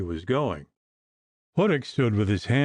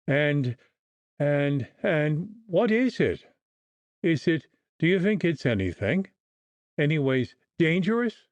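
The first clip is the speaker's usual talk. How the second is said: halting, frightened stammer; faltering; 5 semitones higher than usual. A narrator's reading. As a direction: falter over each word in fear. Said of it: "faltered poor O'Flaherty".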